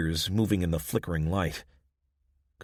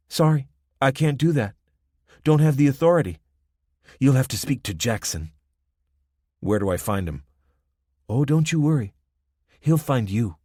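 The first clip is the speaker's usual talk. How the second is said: put-on higher, louder Spanish-accented young woman, lecturing; confident young gang woman; 6 semitones higher, 6 dB louder than usual. Young woman at the roadblock warning the travelers, confident Spanish-accented lecture. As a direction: spell out the rules, sure of her power.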